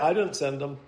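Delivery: God disowning them. Retold or imitated. Preacher voicing God to the congregation, quiet and low. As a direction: drop it low and quiet, like a firm aside.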